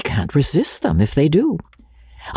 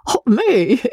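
high, startled "me?" with a giggle; flustered laugh; 11 semitones higher than usual. A narrator's reading. Shy brother singled out by the guest, flustered laugh. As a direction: squeak it and titter nervously.

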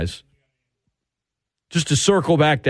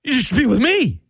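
put-on jealous boyfriend; 8 semitones higher, faster than usual. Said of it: "He's like".

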